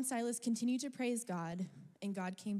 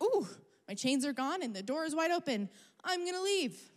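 much higher, louder, gleeful; mock excited prisoner; 7 semitones higher, 6 dB louder than usual. Pretending to be Paul in prison, gleeful mock excitement. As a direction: play a giddy freed prisoner. Imagining herself as freed prisoner.

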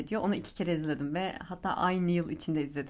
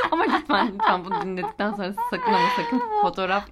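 higher and louder, laughing through it; laughing plea; 6 semitones higher, 5 dB louder than usual. Real, not acted. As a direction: beg them while still laughing. Pleading while laughing loud.

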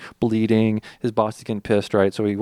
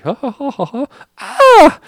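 high mock kiddie giggles that snap into a loud shriek; much higher hammed-up child laugh ending in a yelp; kids laughing then shriek; 11 dB louder than usual. Kids in theater laughing, then shocked.

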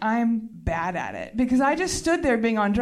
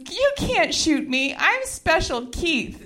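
high, drawn-out proclamation; mock grand defiance; 6 semitones higher, slower than usual. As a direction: declare it slowly, as if untouchable. Retold defiance at gunpoint.

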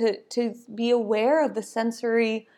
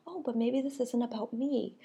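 much softer, gentle second thought; soft realization; 10 dB quieter than usual. Retold or imitated. Person being yelled at, calming themselves with a soft aside. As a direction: let it fall quiet as you reconsider. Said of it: "and then go, like".

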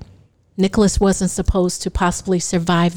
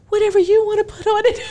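flustered; 10 semitones higher, faster than usual. Retold or imitated. Flustered answer about certificate.

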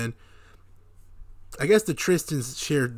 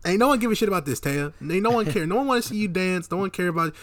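high, loud scoffing at her; mocking dismissal; 7 semitones higher, 4 dB louder than usual. Telling Taya no one wants to see her dance, scoffing. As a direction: brush her off, louder and higher. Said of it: "I'm like".

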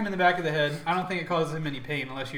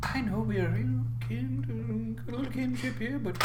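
whisper; 6 semitones higher, 5 dB quieter than usual. Acted. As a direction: draw it out in a hushed, intimate voice. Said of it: "it would come closer to you and whisper".

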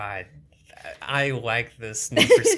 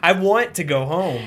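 higher and louder whiny line; amped-up girl imitation; 6 semitones higher, 8 dB louder than usual. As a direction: push the whine harder on command. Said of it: "More, more!".

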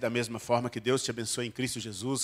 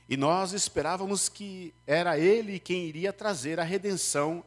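higher, solemn Bible reading; scripture reading; 4 semitones higher than usual. Acted. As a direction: read the passage to the congregation. Reads the Bible passage aloud. (leitura bíblica solene)

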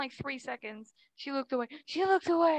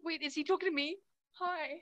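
ordinary delivery

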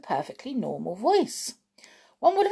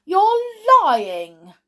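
high, loud outburst; cried out; 11 semitones higher, 11 dB louder than usual. A narrator's reading. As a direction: fling the accusation, outraged. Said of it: "he cried".

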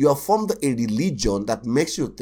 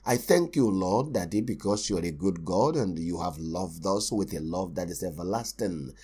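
soft, hushed, low prayer voice; mock soft thankful prayer; gentle quiet prayer; 5 semitones lower, 5 dB quieter than usual. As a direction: pray tenderly and quietly. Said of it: "if I go to a place and say".

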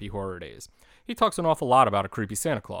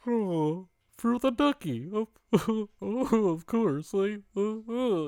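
much higher giggly muppet voice; Ernie gift voice; 12 semitones higher, slower than usual. Retold or imitated. Ernie getting the soap dish, high giggling puppet voice. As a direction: pitch way up and giggle over the ducky.

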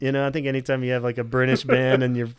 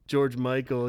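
ordinary talk

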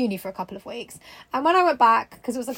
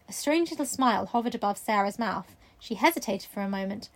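usual speaking voice

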